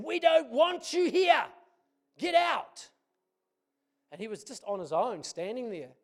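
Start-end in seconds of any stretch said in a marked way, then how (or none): 0.0-2.9 high, slow, shouted yell; retold scream of a hostile youth. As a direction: scream them off the park. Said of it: "someone screamed at us".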